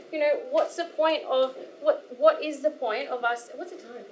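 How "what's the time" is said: usual speaking voice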